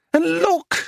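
high-pitched outcry; dismayed cry. A narrator's reading. Dealer spots a tear in the canvas, crying out. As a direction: cry out in alarm at the damage. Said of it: "she cried".

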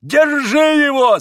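very high, loud drawn-out holler; old man's frantic high scream; wild yell. A narrator's reading. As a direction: yell to have a fugitive seized. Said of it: "завопил он".

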